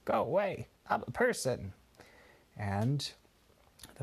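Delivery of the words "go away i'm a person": higher squawky parrot copy of the line; parrot repeating. A narrator's reading. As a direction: parrot it back in a bird voice. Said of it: "the parrot would start repeating".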